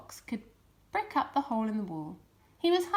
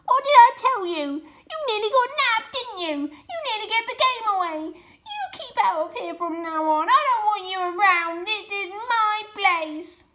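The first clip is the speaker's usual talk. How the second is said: high, loud gloating screech; shrieking rat; 10 semitones higher, 10 dB louder than usual. A narrator's reading. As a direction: screech it at the foxes, cackling. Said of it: "Rat stood ... and shrieked".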